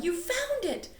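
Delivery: high, delighted; overjoyed; joyful cry. A narrator's reading. Stella thrilled at Miss Graham, joyful. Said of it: "Stella yelled".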